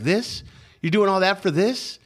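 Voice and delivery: high-pitched disbelieving questions; imitated disbelief; mock incredulity. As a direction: retell the cop's baffled scorn, pitch jumping up. Officer's incredulous reaction to finding pipe.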